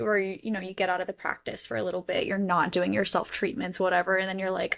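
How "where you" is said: usual speaking voice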